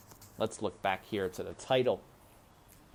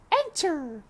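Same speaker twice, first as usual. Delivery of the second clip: high, bright royal call; princess calling; 4 dB louder than usual. A narrator's reading. Princess calling to the knocking maid, high. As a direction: call through the door.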